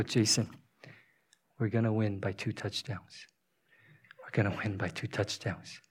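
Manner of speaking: low, hushed, confiding voice; retold whisper. Dad secretly telling crying son they'll win, low and hushed. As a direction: share a secret softly, with pauses. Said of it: "So I whisper in his ear".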